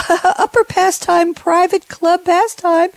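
mock-elite high voice, led in by a laugh; elite snob impression. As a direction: draw it out high like a country-club elitist. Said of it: "to wax a very elite there".

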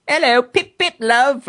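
high-pitched, hammed-up British greeting; badly faked British, played for laughs; mock British accent. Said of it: "I wish I could speak in an accent".

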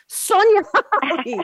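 high, loud, drawn-out name call; big announcer shout. Real, not acted. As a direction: belt out the guest's name, stretching it. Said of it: "couldn't even get that out in one breath".

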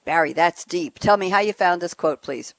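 usual speaking voice

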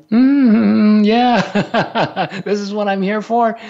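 high, loud, giggly dog voice with a laugh; voicing the dog. The dog basking in the kids' petting, giggly high voice. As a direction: purr it out like the contented dog. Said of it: "Shanti's just going".

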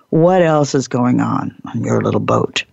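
ordinary talk, nothing marked

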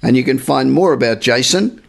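ordinary talk, nothing marked

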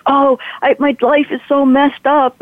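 mild imitation of a sobbing boy, pitched high; retold distraught teen. Fifteen-year-old confiding in the driver, mildly imitated distress. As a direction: plead in a high, upset voice, without real sobs. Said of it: "he's sobbing uncontrollably".

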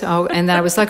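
ordinary talk

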